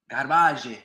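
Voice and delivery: mock French accent. Shown as a demonstration. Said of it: "But in my French accent".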